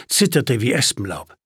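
usual speaking voice

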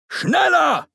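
high-pitched shouted command; impatient shout. A narrator's reading. Father hurries his dawdling daughter, impatient shout. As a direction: yell it to hurry someone up.

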